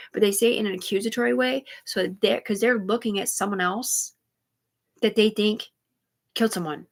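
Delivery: ordinary talk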